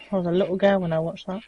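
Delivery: normal talking voice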